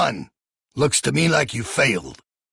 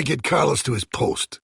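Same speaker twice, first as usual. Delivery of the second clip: normal talking voice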